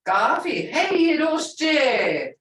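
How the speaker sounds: "coffee, hey, Hiroshi" high and loud, vowels stretched; emphatic tone. Shown as a demonstration. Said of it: "emphasis in a tone".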